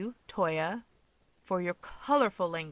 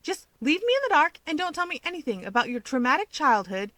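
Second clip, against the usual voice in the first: higher sarcastic outburst; bursting out; 7 semitones higher, 3 dB louder than usual. Acted. Keigo bursting out at Toya, mock outrage. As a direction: blurt it out mockingly. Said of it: "Keigo bursts out".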